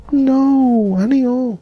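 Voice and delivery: very high squeaky protest; squeak. Acted. Squeaky reaction to chat name.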